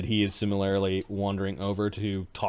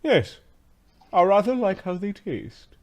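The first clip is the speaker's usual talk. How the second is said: haughty slave master; 10 semitones higher, 4 dB louder than usual. Acted.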